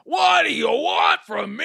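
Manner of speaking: high, slow whiny mobster; Penguin voice. Retold or imitated. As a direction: whine it like a put-upon gangster. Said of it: "I liked his voice throughout it".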